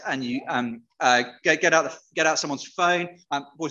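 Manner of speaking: ordinary talk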